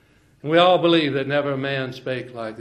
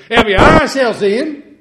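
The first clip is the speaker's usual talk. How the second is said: proclaimed; 11 semitones higher, 16 dB louder than usual. Acted. Proclaimed scripture.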